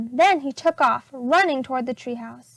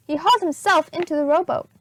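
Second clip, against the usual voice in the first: ordinary delivery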